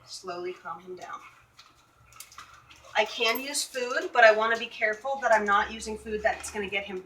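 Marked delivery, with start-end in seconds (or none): 0.1-1.2 softer, gentle repeat; soft slow murmur. Shown as a demonstration. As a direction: murmur it to soothe the dog.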